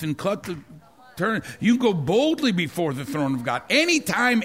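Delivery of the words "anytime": "anytime" thrown up high; soaring pulpit "anytime"; preaching emphasis. Real, not acted. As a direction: lift "anytime" into a ringing peak. Preacher builds to climax.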